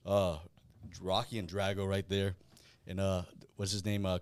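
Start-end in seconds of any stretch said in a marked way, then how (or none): none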